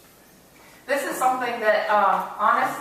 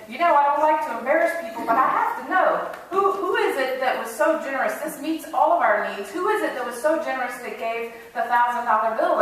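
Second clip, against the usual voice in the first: higher, louder excited preacher; 3 semitones higher, 3 dB louder than usual. Acted. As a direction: gush like a preacher thrilled by a big gift. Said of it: "the preacher got all excited".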